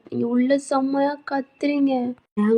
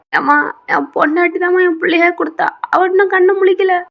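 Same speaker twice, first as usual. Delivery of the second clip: high, wailing plea to mother; acted tearful worry; distraught lament; 5 semitones higher, 4 dB louder than usual. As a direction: pour out grief, voice rising. Husband laments unconscious wife to mother.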